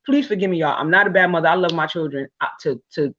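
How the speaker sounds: normal talking voice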